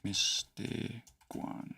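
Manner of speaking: "Mistaguan" very slow and soft, syllable by syllable; sounding out slowly. Shown as a demonstration. Teacher working out the spelling, slowly sounding out "Mistaguan". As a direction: stretch "Mistaguan" into separate quiet syllables. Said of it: "am I spelling it".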